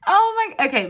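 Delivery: high laughing "oh my" at her own slip; laughing at herself. Real, not acted. Her own flub to her viewers, laughing. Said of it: "blooper reel".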